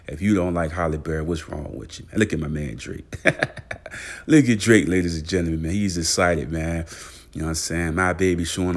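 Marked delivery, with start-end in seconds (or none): 3.2-4.2 short voiceless chuckle; breathy laugh. Real, not acted. Laughing at [name]. 4.3-5.6 excited hype. Pretend. Hyping [name] again.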